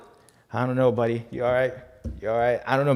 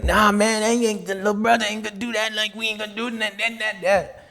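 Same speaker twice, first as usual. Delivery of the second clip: voicing Django; 8 semitones higher than usual. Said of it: "He's like".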